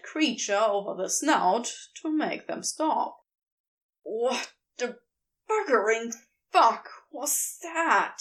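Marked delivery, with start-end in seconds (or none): 4.0-8.2 breathless, wheezy curse after a blow; wheezing. A narrator's reading. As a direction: gasp it out, still short of breath. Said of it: "he wheezes finally when he has his breath back".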